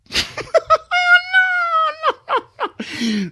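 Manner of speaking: high shrieking laugh breaking into a yell; burst of laughter. Real, not acted. Streamer bursting out laughing after reading the apology, shrieking laugh. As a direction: howl with disbelieving laughter.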